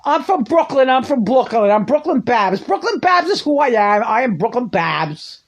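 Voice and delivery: put-on brash Brooklyn accent as Babs; playful Brooklyn accent impression; mock Brooklyn Babs. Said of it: "talking like this".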